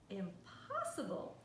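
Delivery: ordinary delivery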